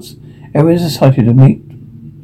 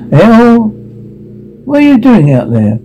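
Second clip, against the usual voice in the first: calling out; 6 semitones higher than usual. Acted. Calling out to someone outside.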